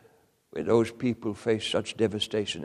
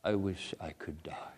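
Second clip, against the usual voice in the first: unvoiced, much softer whisper; 3 semitones lower, 8 dB quieter than usual. Preacher quoting grieving parents, whispered. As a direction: let it fall to a hushed whisper. Parents' despairing words quoted.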